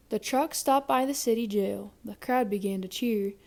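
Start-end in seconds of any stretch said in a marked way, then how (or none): none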